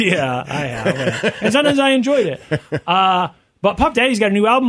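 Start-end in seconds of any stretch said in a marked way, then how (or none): 0.0-2.4 laughing through a confession; chuckling, half embarrassed. Real, not acted. Admits milking a pig.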